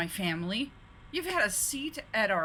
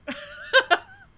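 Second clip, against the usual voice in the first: shrieking laugh; 9 dB louder than usual. Real, not acted. Gleeful over changing title.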